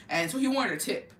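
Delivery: ordinary talk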